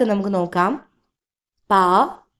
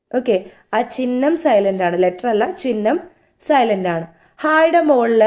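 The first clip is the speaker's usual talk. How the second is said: ordinary delivery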